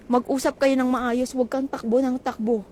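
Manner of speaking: usual speaking voice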